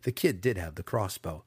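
plain reading voice

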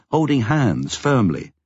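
plain reading voice

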